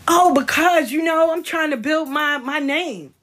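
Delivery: high-pitched mocking imitation; mock excuse. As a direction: mimic an eager excuse, pitched well up. Mimics friends' excuse for wanting interview.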